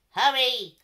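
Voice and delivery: loud, sharp bird squawk; squawking cockatoo. A narrator's reading. Cockatoo squawking a warning to the animals. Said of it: "squawked the cockatoo".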